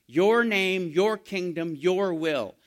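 your pushed out loud in "Your name, your kingdom, your will"; stress on your. Shown as a demonstration. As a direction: lean hard on each your. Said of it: "Three yours".

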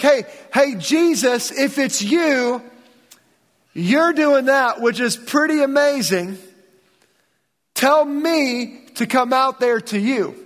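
a bold fisherman daring Jesus to call him out; eager Peter. Acted.

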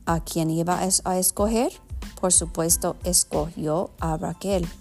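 Spanish with an American accent. Real, not acted. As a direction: read Spanish as an English speaker. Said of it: "perdona mi español".

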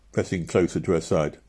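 plain reading voice

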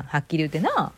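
ordinary delivery

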